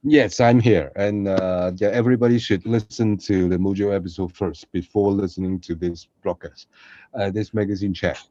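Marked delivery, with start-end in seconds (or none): none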